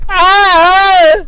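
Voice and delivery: loud high-pitched "ah" of disgust; high frustrated yell. Acted. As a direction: let out a shrill exasperated yell. Ranting about God.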